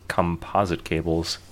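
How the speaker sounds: trailing off quietly; hesitant, drawn-out words; slow and unsure. Real, not acted. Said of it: "Why did you say it like".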